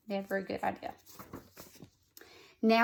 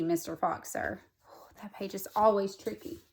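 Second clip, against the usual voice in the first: ordinary delivery, nothing marked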